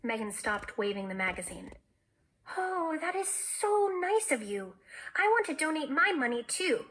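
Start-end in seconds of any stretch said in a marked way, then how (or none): 2.6-6.9 high, gushing, heartfelt delight; gushing admiration. A narrator's reading. Megan touched by her friend's donation plan, gushing. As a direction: gush it high, drawing out the praise.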